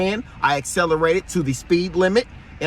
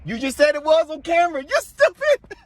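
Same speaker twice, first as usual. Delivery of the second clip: mocking; 10 semitones higher than usual. Real, not acted. Mocking the lieutenant's contradiction.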